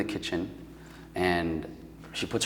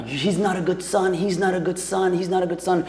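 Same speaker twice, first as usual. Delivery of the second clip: much higher, mock frantic mother yelling; mother flipping out; 9 semitones higher than usual. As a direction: play the frantic mother, pitched way up. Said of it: "My mom is flipping out".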